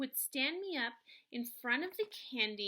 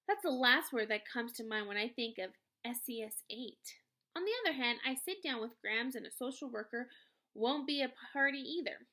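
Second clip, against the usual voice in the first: ordinary delivery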